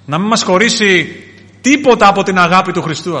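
loud, high declaration; impassioned preaching. Real, not acted. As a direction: proclaim it with full conviction.

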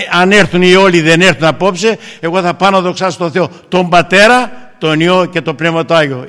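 loud, high, ringing declaration; preacher proclaiming he will go glorify God; climactic preaching. Acted. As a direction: build to a triumphant proclamation.

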